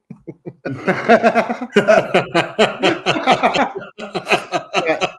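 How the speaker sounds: big real laugh at his own punchline; a full belly laugh after telling the joke; long hearty laugh. Real, not acted. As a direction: laugh out loud, long and free.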